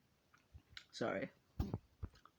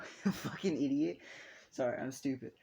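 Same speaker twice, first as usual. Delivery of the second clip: laughing self-reproach; 3 semitones higher, 4 dB louder than usual. Real, not acted. Narrator muddling a character's name, laughing at themselves. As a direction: laugh while cursing your own slip.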